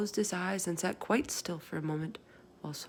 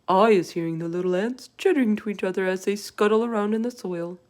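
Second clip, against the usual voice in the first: giant voice; 2 semitones higher, 8 dB louder than usual. Acted. BFG voice.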